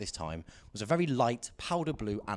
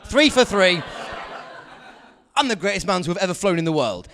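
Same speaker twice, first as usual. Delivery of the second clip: louder, higher boast through laughter; laughing boast; 6 semitones higher, 8 dB louder than usual. Real, not acted. Comic mock boasting to the crowd about his airport blunders, laughing. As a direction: crow about it while laughing. Triumphant self-mocking boast.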